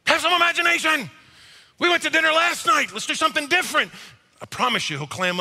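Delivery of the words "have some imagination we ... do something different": high, scornful wife brushing him off; mock the husband's idea, pitched up; dismissive wife. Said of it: "Do not say, please don't say".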